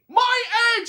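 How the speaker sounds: very high, loud elephant shout. A narrator's reading. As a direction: cry out in wild joy. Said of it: "shouted Horton".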